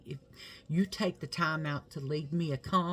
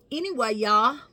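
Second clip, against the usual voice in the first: catchphrase sign-off; 13 dB louder than usual. Real, not acted. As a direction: belt the signature sign-off in a silly voice. Signature channel sign-off.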